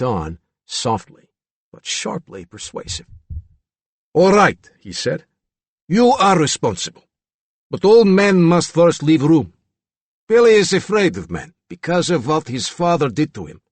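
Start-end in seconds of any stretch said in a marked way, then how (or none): 4.1-4.7 higher, louder character voice; lightly voiced gruff consent; Regan's reply. A narrator's reading. 5.9-13.6 higher, louder character voice; stern, raised character voice; commanding Regan figure. A narrator's reading. Regan, character in narrated scene.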